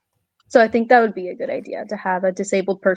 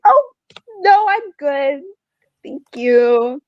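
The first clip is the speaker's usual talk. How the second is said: high, slow, overly polite brush-off; polite awkward refusal; 6 semitones higher, slower than usual. Retold or imitated. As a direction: decline sweetly and a little awkwardly. Polite refusal to patronising mom.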